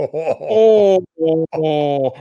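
mock embarrassed student. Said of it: "And I'm like".

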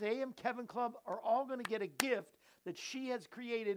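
ordinary talk, nothing marked

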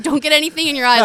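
doctor's warning. Retold or imitated. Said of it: "the doctor said".

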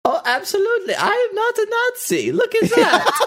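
put-on high, far-off German accent with a laugh at the end; Valgor's German accent. Voice actor answering the host in Valgor's German accent. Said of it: "the German accent usually is for an evil person".

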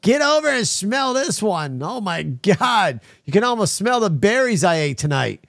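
high, slow, hammed-up bragging voice; mock proud farter. Host asking chat, mocking proud fart-sniffers in high voice. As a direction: show off your fart like a proud fool. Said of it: "you feel proud of yourselves and you're like".

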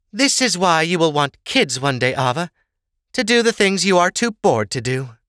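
high-pitched motherly lecture; mother advising daughter. Acted. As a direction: press the point on your daughter, pitched high. Sorsha lectures her daughter.

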